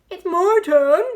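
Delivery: insistent demand. A narrator's reading. Badger demanding the jar, loud and eager. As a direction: claim it loudly.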